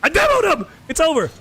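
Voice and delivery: loud, high triumphant shout; excited yell. Real, not acted. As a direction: crow over the demo. Scoring a demo in the game.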